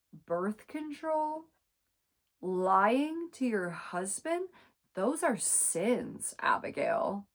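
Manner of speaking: slow, prim, scandalized churchy voice; pious scolding. Retold or imitated. Sister mocked as sanctimonious, slow prim disapproval. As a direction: drag out the words like a pious scold. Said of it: "what Natalie says to this".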